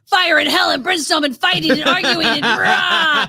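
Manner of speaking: high, loud, drawn-out roar of mayhem; mock chaos shout. Host acting out the hellish inside of the fandom, mock frantic shouting. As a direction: bellow it like comic pandemonium.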